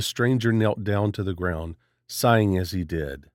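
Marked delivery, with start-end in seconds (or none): none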